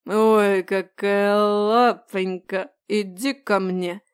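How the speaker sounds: put-on slow, slurred leering drunk. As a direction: stretch it out, tipsy and leering.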